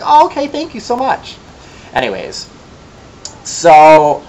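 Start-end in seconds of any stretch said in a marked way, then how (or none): 0.0-1.4 fake-sweet thanks. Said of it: "I was like".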